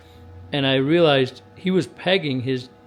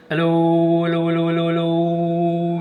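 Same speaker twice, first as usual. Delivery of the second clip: long drawn-out hellos, louder; loud radio mic check; 3 semitones higher, 4 dB louder than usual. Real, not acted. Keying the CB, calling a long loud hello. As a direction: test call stretched slow into the mic.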